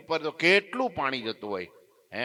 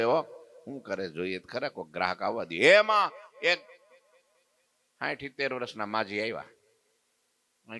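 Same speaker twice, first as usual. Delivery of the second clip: normal talking voice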